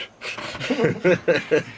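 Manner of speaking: sudden high laugh; high burst of laughter. Real, not acted. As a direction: break into laughter. Laugh after retelling.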